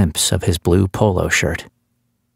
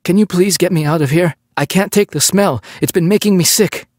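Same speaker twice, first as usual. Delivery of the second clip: pleading captive; 12 semitones higher than usual. Acted. Captive evading the cop's question, high pleading. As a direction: beg to get out, dodging the question.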